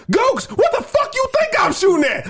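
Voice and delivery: high, exasperated screaming retort; crazed gunner's yell. Retold or imitated. Gunner yelling back at the captain, high crazed retort. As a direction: bite back like he's lost it.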